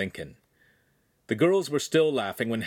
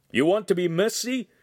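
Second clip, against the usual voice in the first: playful master's taunt, pitched up; teasing; 7 semitones higher than usual. A narrator's reading. Master to Lincoln in a playful headlock, teasing. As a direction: tease him with mock threat. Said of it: "the master teased".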